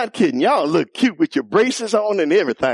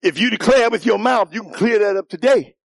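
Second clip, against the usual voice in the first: raised, ringing altar call; preaching call; 6 semitones higher than usual. Real, not acted. Preacher urging the young people to declare, raised voice. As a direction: call them up with fire. Preacher calling congregation to salvation.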